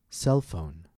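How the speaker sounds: "Cell phone" with CELL stressed, phone dropping; stress on first noun. Shown as a demonstration. Teacher modelling compound nouns for repetition, "Cell phone" stressed on CELL. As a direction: hit "Cell", let "phone" fall away. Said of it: "stress of these nouns falls on the first noun".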